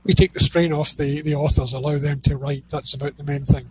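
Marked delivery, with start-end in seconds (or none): none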